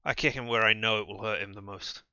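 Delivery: normal talking voice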